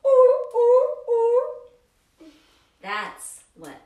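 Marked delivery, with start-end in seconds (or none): 0.0-2.3 high, loud hooting ooh; walrus bellow. Pretend. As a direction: hoot it out like a walrus. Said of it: "Bellowing like a walrus".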